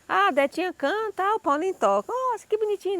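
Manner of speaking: high, sugary gossip voice; mock sweet, high chatter; gossiping churchgoers. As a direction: coo like church ladies matchmaking. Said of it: "o povo logo começou a juntar".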